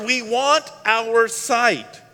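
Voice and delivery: slow, loud, high cry; drawn-out, full-voiced appeal; shouted plea. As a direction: shout each word out with longing. Blind men calling back to Jesus.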